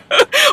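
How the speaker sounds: squealing laugh, way up high; a quick high burst of laughter; giggling at the pheromone joke. Real, not acted. Laughing at her own joke.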